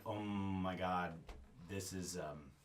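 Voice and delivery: slow, low and hushed; sinking realisation. Acted. GM voicing a player's vulnerable feeling, hushed sinking realisation. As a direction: drop low and soft, as a private thought.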